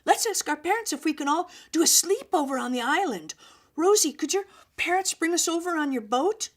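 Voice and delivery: high and loud, eager plea to friends; excited. A narrator's reading. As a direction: keep the excited rush going. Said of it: "Stephanie said excitedly".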